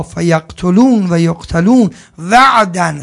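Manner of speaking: slow, loud chanted recitation; Quran recitation. Real, not acted. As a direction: intone the verse slowly and fully. (تلاوت آهنگین قرآن)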